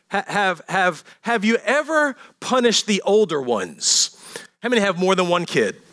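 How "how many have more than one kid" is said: normal talking voice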